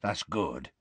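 soft, low, absent-minded reply; distracted. A narrator's reading. Hagrid half-listens to Harry's news, distractedly. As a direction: trail it out softly, mind elsewhere. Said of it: "said Hagrid distractedly".

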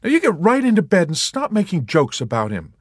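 high, firm order; scolding nurse. A narrator's reading. Nurse Kramer ordering Yossarian to bed, firm. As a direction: send him back to bed.

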